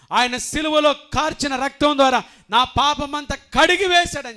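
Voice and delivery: loud, high rising proclamation; fervent declaration. Acted. Believer answering the devil, proclaiming loudly.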